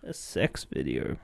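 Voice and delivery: very low, slow, sultry joke voice; deep joke voice. Pretend. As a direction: drop the voice way down for the joke.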